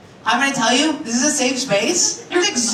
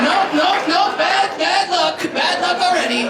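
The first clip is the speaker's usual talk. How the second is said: emphatic refusal; 6 semitones higher, slower than usual. Retold or imitated. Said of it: "I was like".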